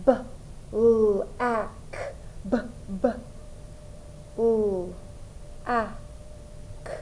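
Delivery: "b, l, a, k" as isolated sounds with pauses; sounding out. Shown as a demonstration. Teacher counting the sounds in a syllable, sounding out. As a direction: say each sound of "b, l, a, k" on its own. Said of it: "sound it out".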